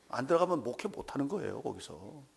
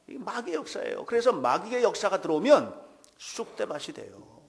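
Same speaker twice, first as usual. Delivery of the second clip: ordinary talk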